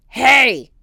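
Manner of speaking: loud, rough yell; horrible voice. Acted. The captain yells at Jack, loud and rough. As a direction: bark it out. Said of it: "came a horrible voice".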